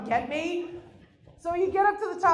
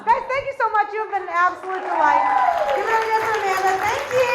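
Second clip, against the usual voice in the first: much higher, louder emcee thank-you; comic closing the set for the crowd, higher and louder emcee voice; announcer sign-off; 9 semitones higher, 8 dB louder, faster than usual. Acted. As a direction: hype up the crowd as you leave. Closes set as host.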